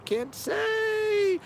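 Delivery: mock cop refusing to answer; squeaky mock officer. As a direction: squeak it out coyly like a cop keeping a secret. Mock Hendrix repeats the refusal.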